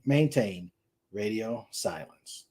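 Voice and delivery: ordinary delivery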